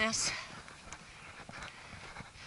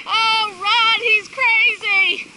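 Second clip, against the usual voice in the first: laughing exclaim; 11 semitones higher, 6 dB louder, much slower than usual. Real, not acted. Laughing mid-sled ride.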